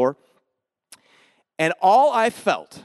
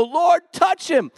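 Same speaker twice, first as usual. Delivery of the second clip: high, slow, raised-voice invocation; fervent prayer cry; 11 semitones higher, 4 dB louder, slower than usual. Retold or imitated. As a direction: call it out as a bold prayer. Retold prayer over friend.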